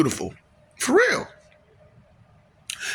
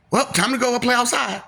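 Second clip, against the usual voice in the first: mock cheerful announcer; 7 semitones higher than usual. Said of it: "it was like".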